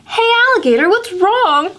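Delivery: high, bright call; Pete calling out. Acted. Pete greeting sad Alligator, high and bright. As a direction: check on a friend.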